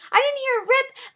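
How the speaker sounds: squeaked in total disbelief; squeaky disbelief. Real, not acted. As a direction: peak of the baffled panic. That's my sort of freak out.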